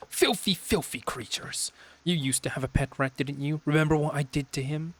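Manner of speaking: high, cruel sister drawing out filthy; gloating sister. Acted. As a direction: relish the memory of the rat. Sister gloats about pet rat.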